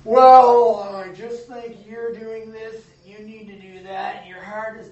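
slow, lightly voiced lecturing tone; preachy know-it-all. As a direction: play the smug adviser who knows best. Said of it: "mature believers who are like".

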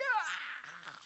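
high, restrained mock shriek; drawn-out, softened scream in imitation; imitated demon shriek. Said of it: "he came out of him with a shriek".

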